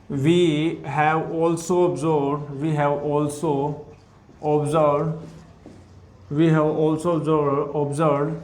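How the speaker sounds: very slow, each word spaced; slow dictation. Shown as a demonstration. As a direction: dictate slowly for students to copy. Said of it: "dictating notes slowly".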